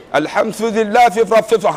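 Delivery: mock toothless recitation, consonants hissing away; toothless slurring. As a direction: garble it like a man with no teeth. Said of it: "kalau tak ada gigi". (sebutan pelat tak bergigi)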